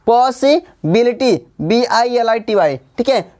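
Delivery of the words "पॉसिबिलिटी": "पॉसिबिलिटी" slowly, in separated parts, पॉसि-बिलिटी; teacher piecing "पॉसिबिलिटी" together slowly; syllable by syllable. Shown as a demonstration. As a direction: break "पॉसिबिलिटी" after पॉसि. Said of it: "इसमें दो से अधिक syllables हैं".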